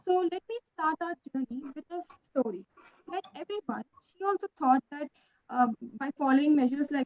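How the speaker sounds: faint, far from the mic; barely audible. Real, not acted. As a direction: voice too faint. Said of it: "Voice is very faint".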